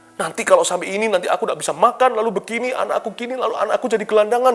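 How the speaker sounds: anxious worrying. Retold or imitated. Voicing a fearful believer.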